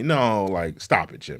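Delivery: ordinary talk, nothing marked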